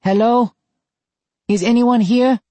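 high, loud, nervous call into the dark. Acted. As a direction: call out, unsure anyone's there. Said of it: "he called out".

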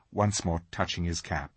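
usual speaking voice

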